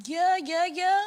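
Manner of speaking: a father caught off guard; stunned dad. Retold or imitated. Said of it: "he was like".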